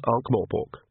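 usual speaking voice

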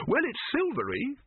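high, hesitant alchemist voice; unsure, trailing voice; doubtful Silverfish. A narrator's reading. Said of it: "said Silverfish doubtfully".

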